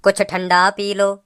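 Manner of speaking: low, slow coaxing offer. Acted. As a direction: coax her gently, slowly. Boyfriend coaxing girlfriend to drink.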